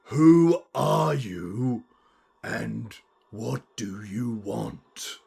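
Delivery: slow, drawn-out, emphatic menace. Acted. Unseen being repeats itself for Nana, slow and emphatic. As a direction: spell it out word by word. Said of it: "could you speak up?".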